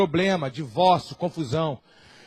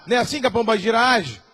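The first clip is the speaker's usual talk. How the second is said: normal talking voice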